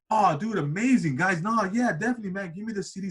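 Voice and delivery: high, eager mock enthusiasm; imitating excited Fernando. Fernando gushing to the two kids, high and eager. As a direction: play the boxer gushing over the demo.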